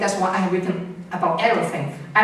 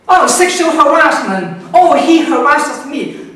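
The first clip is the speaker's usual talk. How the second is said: higher, much louder hammed-up mock complaint, slowing on the second line; mocking complaining women; 4 semitones higher, 8 dB louder than usual. Mocking women who complain about small things, hammed-up and loud. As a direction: parody an over-the-top victim's outcry. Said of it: "The more you talk like this".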